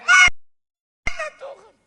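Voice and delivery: slow, drawn-out high scream; desperate shout. Retold or imitated. Retelling the cry to God before the bus came, desperate shout. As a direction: wail the question out at full force. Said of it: "Tapi ketika saya teriak".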